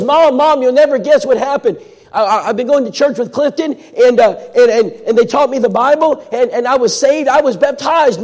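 high, loud, tumbling and stammering with joy; can hardly get the words out for excitement; breathless excitement. Retold or imitated. Callie bursting to tell her mom she was baptized, high and breathless.

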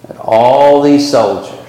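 usual speaking voice

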